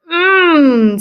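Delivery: high, loud yummy mmm; delighted mmm. Acted. Camilla tasting the beans, loud delighted mmm. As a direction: savor it out loud. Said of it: "said Camilla".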